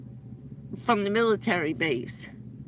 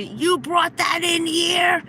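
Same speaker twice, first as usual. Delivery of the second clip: retold shout; 7 semitones higher, slower than usual. Hacker yelling at her for bringing the laptop, shouting. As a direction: roar it at her in disbelief. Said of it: "cursing and screaming like a maniac".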